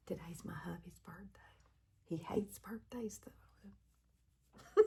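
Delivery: hushed, whispered confidential aside; keeping it on the low. Real, not acted. Vlogger confiding about hubby's birthday, whispered on the low. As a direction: whisper it like a secret. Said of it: "on the low".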